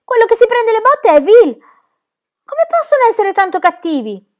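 put-on a frightened girl crying out; shocked cry. A narrator's reading. Annika sees Vil being beaten, high and distressed. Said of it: "esclamò Annika".

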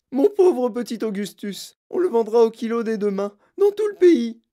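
loud, high wail; lamenting. A narrator's reading. Mother pities her poor son, lamenting. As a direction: bewail it, climbing to a shriek. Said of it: "se lamenta Madame Gloop".

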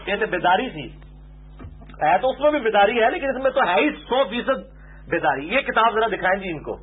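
normal talking voice

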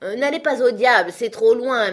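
loud, agitated backtracking; flustered retraction. A narrator's reading. Benjamin apologising to his sister, flustered retraction. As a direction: hastily take back the outburst.

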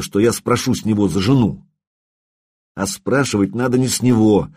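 ordinary delivery

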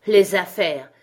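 loud, bitter spectral outcry; ghostly cry. Acted. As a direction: fling the word back in anguish. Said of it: "s'écria le fantôme". (le spectre s'écrie, fort et amer)

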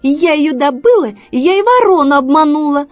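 high, loud fox outrage; indignant squeal. Acted. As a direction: protest shrilly over the prize. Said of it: "возмущённо завизжала лиса".

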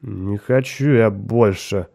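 put-on slow slurred drunken mumble. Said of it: "пьяным голосом пробормотал Эннис".

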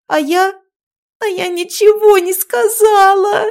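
loud, high, drawn-out acted wail; bursting into tears. A narrator's reading. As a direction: wail it like a girl breaking down. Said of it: "разревелась". (навзрыд, с рёвом)

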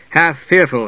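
ordinary delivery

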